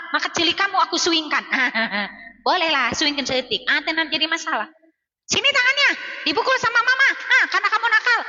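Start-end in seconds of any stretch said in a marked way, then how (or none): 5.3-8.2 a touch of a hammed-up strict mum; stern scolding mum. Shown as a demonstration. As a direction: scold the naughty child and threaten a hand smack. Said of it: "Kayak gitu, Bun".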